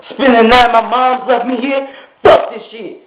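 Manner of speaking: ordinary delivery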